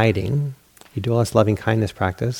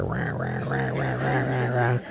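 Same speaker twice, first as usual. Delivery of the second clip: angry growling; 6 dB quieter, slower than usual. Retold or imitated. Stomping angrily in the snow.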